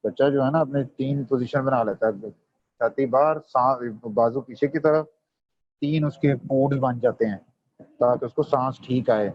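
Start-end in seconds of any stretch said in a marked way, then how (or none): none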